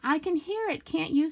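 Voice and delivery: high anxious whisper-tone; nervous little question; anxious Piglet. Acted. Piglet nervously asking Christopher Robin near the trap. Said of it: "said Piglet anxiously".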